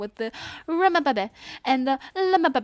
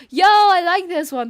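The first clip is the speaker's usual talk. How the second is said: excited shout; 5 semitones higher, 9 dB louder than usual. Real, not acted. Excited gamer reacting to song.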